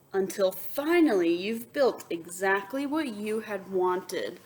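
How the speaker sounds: bright read-aloud to the class; lively storybook voice; story narration. A narrator's reading. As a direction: read it like a picture book to kids. Reads picture book aloud.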